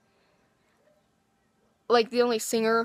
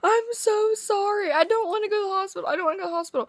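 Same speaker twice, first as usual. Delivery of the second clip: imitating crying grandma; 9 semitones higher, faster than usual. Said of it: "She was like, crying".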